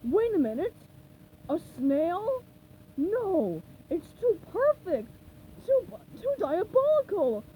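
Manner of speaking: villainous glee building. Acted. Plankton realising his evil plan, building to a gleeful shout.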